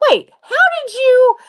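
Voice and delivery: startled high squeak. Retold or imitated.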